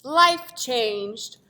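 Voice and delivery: usual speaking voice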